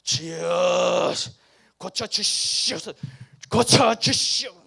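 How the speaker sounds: slow, loud drawn-out prayer cry; mock fervent healing prayer. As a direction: ham up a showy faith healer's prayer. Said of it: "안찰 기도라고 해서 막 두들 패면서 막 기도하는 게". (과장된 안수 기도 흉내)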